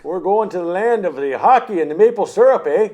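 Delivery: put-on loud, high Canadian accent; hammed-up Canadian accent; mock Canadian accent. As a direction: sell the maple syrup like a Canadian. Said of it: "keep this accent".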